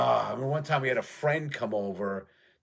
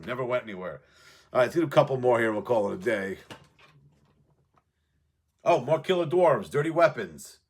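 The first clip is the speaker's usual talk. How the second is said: normal talking voice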